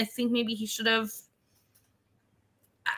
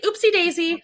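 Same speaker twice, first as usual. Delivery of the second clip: high, playful oops voice; mock carelessness; 7 semitones higher, 4 dB louder than usual. Mocking the idea Brett slipped up, sing-song oops. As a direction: act out a silly little accident.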